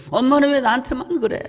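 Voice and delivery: 높고 억울한 아이 목소리로; 엄마에게 억울하게 따지는 어린 동생; child whining. Retold or imitated. Younger child protesting. (엄마한테 억울하게 항의하는 아이)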